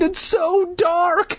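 very high, drawn-out panicked wail; hammed-up high cry; shouting. A narrator's reading. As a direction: wail it slowly in mock panic. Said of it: "Luke shouted".

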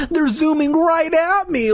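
high-pitched whiny wail; hammed-up squealing panic. A narrator's reading. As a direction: wail it in mock terror. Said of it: "Luke wailed like a bitch".

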